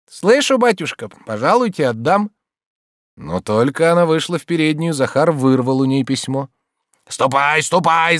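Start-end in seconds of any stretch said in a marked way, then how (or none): 0.1-2.3 high-pitched obliging maidservant; eager maid. A narrator's reading. Maid promises master to deliver the letter, eager and high. 7.1-8.2 raised, cross shooing; shouted order. A narrator's reading. Servant chases the maid away, cross shout. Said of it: "закричал он".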